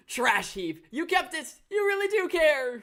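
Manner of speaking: an overjoyed host moved by a gift; host gushing over a kept keepsake; touched TV host. Acted. Reading the host's lines.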